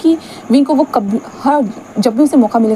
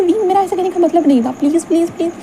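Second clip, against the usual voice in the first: higher, pleading voice; desperate plea, pitched up; begging; 6 semitones higher than usual. Acted. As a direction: beg him not to walk away. Wang Ji begs Wing after fight.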